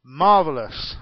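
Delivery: normal talking voice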